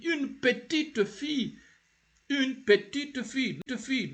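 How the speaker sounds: high-pitched model of "une petite fille", T in "petite" released; sounded final T. Shown as a demonstration. Teacher has learners repeat after her, sounded T. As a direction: say "petite" with its last T heard, for repeating. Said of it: "repeat after me".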